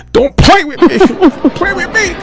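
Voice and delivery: high-pitched playful warning breaking into laughter; joking, very high and drawn out; mock warning. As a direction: squeal it in mock offence. Mock warning quote, then laughter.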